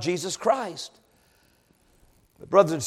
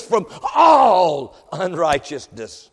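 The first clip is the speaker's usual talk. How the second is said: long, weighty "all", falling away slowly; emphasis on all; 3 semitones lower, slower than usual. Real, not acted. Preacher quoting First John to the congregation, heavy emphasis on "all". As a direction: stretch "all" out before letting the phrase drop. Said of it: "Did I put enough emphasis on the word all?".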